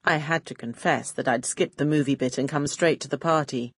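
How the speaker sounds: usual speaking voice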